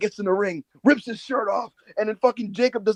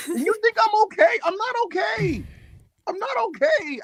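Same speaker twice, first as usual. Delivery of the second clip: much higher, hammed-up whine of exhaustion; mock distress. Wrestling fan defending himself to co-hosts, hammed-up frazzled whine. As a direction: whine it like a wrecked fan begging for pity. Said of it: "watched six hours of GCW".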